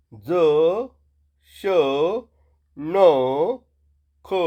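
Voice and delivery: each letter called out alone with pauses; slow call-and-repeat of consonants; letter drill. Shown as a demonstration. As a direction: call every letter clearly for the class to echo. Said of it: "माझ्या मागे म्हणा".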